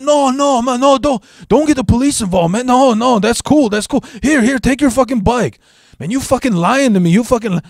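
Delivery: put-on panicky, high Cheech-style Mexican accent; Mexican accent impression. Thief begs him not to call police, panicky Mexican accent. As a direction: plead and protest in an imitated high Mexican accent. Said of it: "that typical Cheech Mexican accent".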